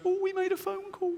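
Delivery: high, panicky inner voice blurting it out; a squeaky scared voice in his head; fearful inner voice. Retold or imitated. Comedian voicing the scared side of his mind, high and panicky. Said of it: "This one goes".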